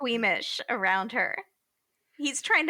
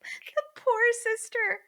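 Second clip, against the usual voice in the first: much higher, slow pitying sigh; mock pity; slower than usual. Real, not acted. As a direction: coo it like the sister has it rough. Pitying the sister.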